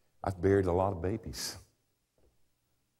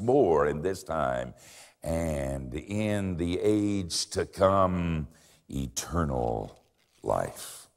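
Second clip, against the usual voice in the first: slow solemn reading; much slower than usual. Retold or imitated. Scripture quote slowing.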